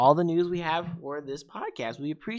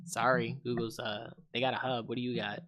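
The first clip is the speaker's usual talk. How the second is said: low mock Bixby; 6 semitones lower, 6 dB quieter than usual. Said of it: "It's like".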